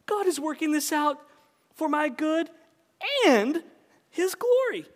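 climbing to high his glory; stress on his glory. Shown as a demonstration. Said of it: "emphasize different words in that sentence".

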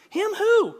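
very high, loud question; acted exasperated wife; sharp challenge. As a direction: fire it back in disbelief.

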